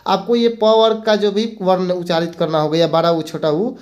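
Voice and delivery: usual speaking voice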